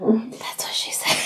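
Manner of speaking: cheeky whispered innuendo; whispered joke. Real, not acted. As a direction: slip it in under your breath, whispering.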